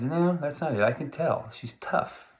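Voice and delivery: knowing John. Retold or imitated. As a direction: needle him with a sly guess. John guessing, acted quote.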